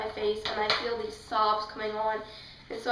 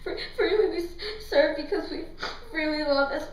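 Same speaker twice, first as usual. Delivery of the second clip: high, broken, tearful reading with catches on the words; imitating sobbing while reading; 6 semitones higher than usual. Retelling reading the quote aloud to viewers, mock sobbing. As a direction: stammer through the lines like you're choking back sobs. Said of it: "so I'm reading as I'm sobbing".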